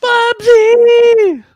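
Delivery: long high shout of the name. Pretend. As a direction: yell the name out.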